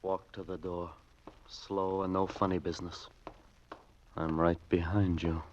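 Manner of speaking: low, soft, cold commands; low menacing order. Acted. Gunman quietly marching hostages to the door, menacing. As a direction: keep it low and threatening.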